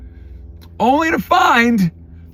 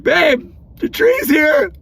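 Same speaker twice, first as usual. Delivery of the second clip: much higher gleeful news; excited announcement; 9 semitones higher, much slower than usual. Retold or imitated. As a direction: sing it out to wife. Excited to wife about tree.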